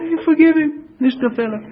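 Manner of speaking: high-pitched, easy shrug-off; casual forgiveness. Retold or imitated. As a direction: let it go as no big deal. Voicing easy forgiveness of small wrongs.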